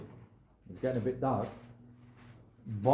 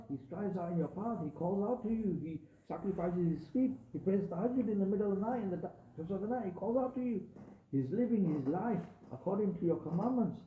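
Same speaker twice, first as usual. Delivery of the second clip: angels pleading, much higher and straining in disbelief; bewildered angels; 8 semitones higher than usual. Acted. The angels, much higher, protest to Allah that His servant was deprived. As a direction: cry up to heaven as baffled angels.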